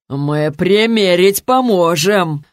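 slow, drawn-out lewd offer; leering drunk thug. A narrator's reading. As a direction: stretch it out in a mocking lout's voice. Drunk thug leering at his victim. (тягучее пьяное глумление)

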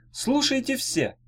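high, slow call for attention; mild calling out. A narrator's reading. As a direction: call the crowd to order, high and drawn out. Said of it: "крикнул он".

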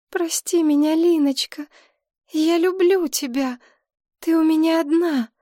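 high, pleading voice of an ageing mother; anxious mother. A narrator's reading. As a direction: lift the voice high, like a fretful old mother. Mother's voice in the story. (высокий, жалобный голос пожилой матери)

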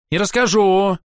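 put-on petulant child stamping, high; sulky insistence; childish tantrum. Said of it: "топнул ногой ... капризного ребёнка".

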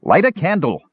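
put-on half-elf ordering the old elf to light a candle. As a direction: bark the order at the old man. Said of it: "Tanis ordered".

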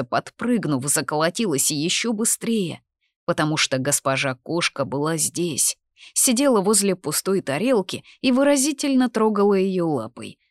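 ordinary delivery

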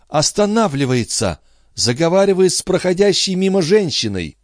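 high, rapt voice of a woman seeing far off; entranced seer. Acted. Entranced seer following the boy.

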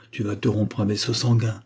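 ordinary delivery, nothing marked

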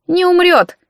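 high-pitched granny contradicting; confident old woman, high voice; dismissive old woman. A narrator's reading. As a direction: brush off the worry, pitched well up.

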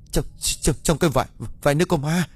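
high, fast, breathy stutter; trembling stammer. Acted. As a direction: stammer out in shaking fear. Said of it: "run rẩy". (lắp bắp run rẩy vì sợ)

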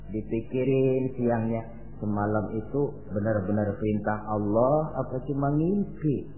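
low, soft musing; pondering. Acted. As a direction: think it over quietly to yourself. Ibrahim mulls over his dream. (merenung pelan, ragu)

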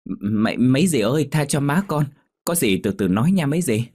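high, pleading voice of a daughter begging the aunts. A narrator's reading. Daughter begging the fishwives to spare her mother, pleading. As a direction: beg them to spare your mother. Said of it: "quay sang năn nỉ".

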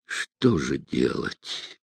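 low, slow and hushed; inner thought. A narrator's reading. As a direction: think it to yourself, softly and slowly. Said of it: "подумал Невельской".

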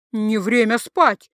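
slow, loud grand declaration; pathetic proclamation. Acted. Drunk Antonio proclaims to Tatsu, slow, loud and grand. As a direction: proclaim it like a hero. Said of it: "патетично возвестил тот".

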